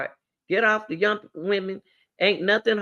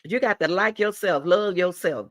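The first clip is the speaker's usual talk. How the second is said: usual speaking voice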